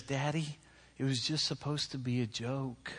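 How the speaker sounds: soft, hushed imitation of a small child; quiet, hesitant child's explanation; littlest child. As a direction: plead softly as a little kid to daddy. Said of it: "the littlest one".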